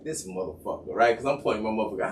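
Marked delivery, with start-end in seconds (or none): 0.0-0.9 low, soft irritated mutter; quiet simmering annoyance. Retold or imitated. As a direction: grumble it under your breath. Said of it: "I'm irritated".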